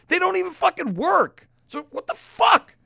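high, loud exasperation; exasperated outburst. Real, not acted. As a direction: let the pitch leap in disbelief. Exasperated rant about masks.

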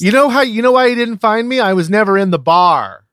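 high, fast, defiant wife voice; acted wife. Recapping the wife, high and fast. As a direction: rattle it off high and quick as the wife.

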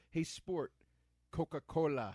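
smug bowler. Retold or imitated. A smug bowler ordering at the bar, condescending. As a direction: say it like a douchey boyfriend. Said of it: "and he goes".

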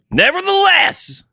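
hammed-up tough-guy declaration; boasting villain. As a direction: proclaim it high and grand, mock-menacing. Acted villain's boast, repeated.

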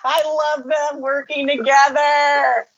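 delighted giggle while gushing; gushing over the show, laughing. Real, not acted.

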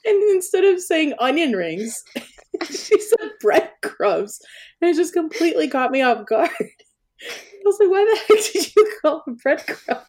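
high laughing retelling; can barely talk for laughing. Real, not acted. Laughing through the story.

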